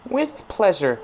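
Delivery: high-pitched mock-polite echo; mocking imitation. Parrots game character's reply.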